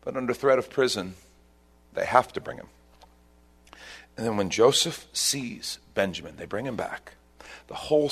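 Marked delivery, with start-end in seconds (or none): none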